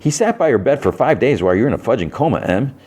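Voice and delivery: high, lively retold protest; mock exasperated insistence. Adebayo arguing with Harcourt about Chris, insistent and exasperated. Friend argues Chris loves her.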